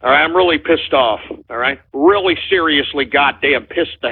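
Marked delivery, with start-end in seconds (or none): none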